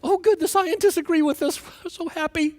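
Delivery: giddy sarcastic cheer, laughing; mocking voice. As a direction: parody the gushing believers. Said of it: "it's like".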